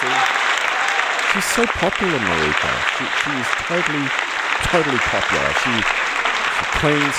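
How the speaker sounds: mock-adoring loud gush. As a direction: swoon over her name. Gushing over Marika.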